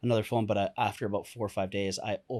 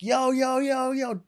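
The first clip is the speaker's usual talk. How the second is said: high, loud frantic yell; panicked scream; 13 semitones higher, 4 dB louder, slower than usual. Retold or imitated. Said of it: "So I'm screaming".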